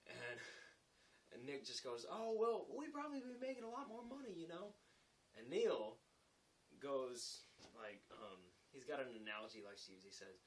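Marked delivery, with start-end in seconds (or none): 2.1-4.7 high-pitched, teasing retort; breezy comeback. Retold or imitated. Carpenter answering the laborer's jab, teasing. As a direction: needle him with mock innocence.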